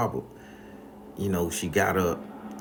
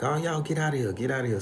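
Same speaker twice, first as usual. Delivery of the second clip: shooing grandma; 5 semitones higher, faster than usual. Retold or imitated. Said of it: "grandma was like".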